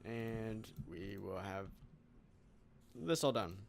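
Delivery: talking through hiccups; hiccuping. Real, not acted. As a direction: speak with the hiccups. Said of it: "Excuse me, I'm hiccuping".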